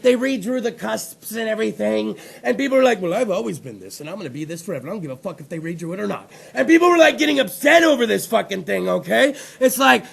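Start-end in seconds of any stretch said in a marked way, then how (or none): 3.0-6.3 stubborn retort. Retold or imitated. Retells people's stubborn reply.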